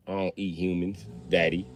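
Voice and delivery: put-on low, soft creepy kid voice; creepy calm kid. Son assuring his dad, low and creepy. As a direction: murmur it low and eerie.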